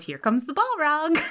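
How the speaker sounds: high-pitched, excited announcement; excited older lady. Retold or imitated. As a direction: imitate a lady thrilled by what's coming. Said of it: "all excited".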